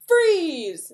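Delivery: loud, high mock police yell; sudden play-acted order; cop's command shout. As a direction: shout it like a cop halting suspects. Acted cop stopping suspects.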